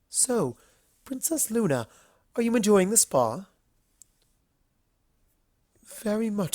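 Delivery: all polite charm, a little nervous; refined Rarity. Acted. Rarity voiced in the story.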